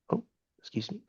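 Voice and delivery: soft, whispered apology; hushed aside. Real, not acted. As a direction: excuse yourself under your breath, barely voiced. Host breaks off to excuse himself.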